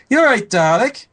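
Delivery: very high, loud squeaky friend voice. Retold or imitated. Friends voiced in retold meeting.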